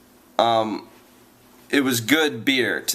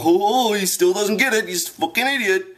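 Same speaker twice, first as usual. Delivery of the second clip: mocking the clerks; 9 semitones higher than usual. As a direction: parody the snotty clerks mocking him. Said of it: "they're getting all mad".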